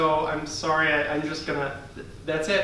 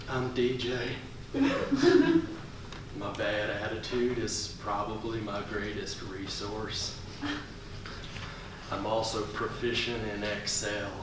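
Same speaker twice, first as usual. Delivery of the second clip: much lower, quiet, sullen voice; deadpan; 8 semitones lower, 12 dB quieter than usual. Acted. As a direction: mumble it like a bored slacker. DJ character, low deadpan.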